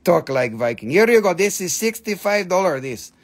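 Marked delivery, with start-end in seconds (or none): 0.0-0.9 lightly lowered mock viking voice. Shown as a demonstration. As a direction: ham up a Viking warrior. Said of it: "I have to learn how the Viking is talking".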